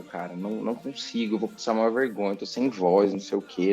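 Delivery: ordinary talk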